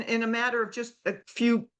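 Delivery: usual speaking voice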